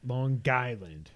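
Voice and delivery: slow, local Long Island pronunciation, "Lawn GUY-land"; Long Island accent. Shown as a demonstration. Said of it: "That's how they say it".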